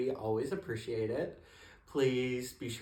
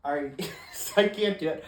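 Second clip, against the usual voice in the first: ordinary delivery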